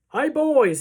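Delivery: high, loud hail; waiter's hearty holler; shouted greeting. A narrator's reading. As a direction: call out to the whole kitchen. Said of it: "he shouted".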